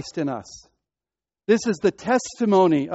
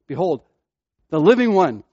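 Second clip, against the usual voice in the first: "living one" punched louder after a pause; stress on living one; 7 dB louder than usual. Shown as a demonstration. Preacher showing the congregation the emphasis, "living one" stressed. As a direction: pause after "Behold", then hit "living" hard and fall. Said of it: "Isn't it not upon the living one?".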